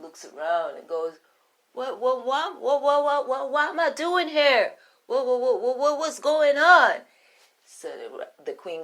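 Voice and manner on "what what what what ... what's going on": flustered rabbit. Acted. Rabbit confused.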